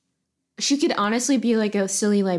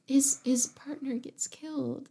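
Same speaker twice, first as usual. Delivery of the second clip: whisper; 2 semitones higher, 9 dB quieter than usual. Real, not acted. Gasping at a sad idea.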